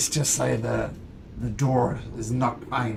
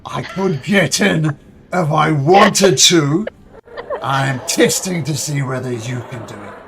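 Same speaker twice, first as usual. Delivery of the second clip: boastful dragon; 3 semitones higher, 12 dB louder than usual. Acted. Dragon challenged about getting in, loudly boastful. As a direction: puff up with wounded pride, drawing out the words. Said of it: "he is being boastful and prideful".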